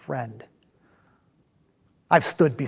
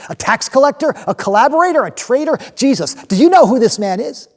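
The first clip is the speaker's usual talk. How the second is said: outraged; 9 semitones higher, 7 dB louder than usual. Acted. Outraged at Matthew joining.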